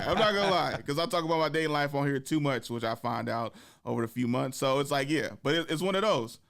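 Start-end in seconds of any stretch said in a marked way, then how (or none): none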